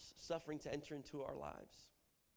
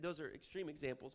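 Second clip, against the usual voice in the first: usual speaking voice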